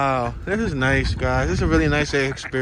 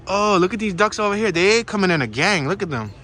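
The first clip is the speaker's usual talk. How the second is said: ordinary delivery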